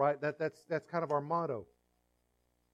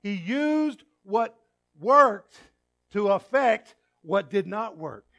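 preaching emphasis; 4 semitones higher, 8 dB louder, slower than usual. Real, not acted. Preacher drives home his point.

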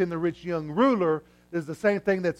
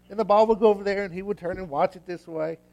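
usual speaking voice

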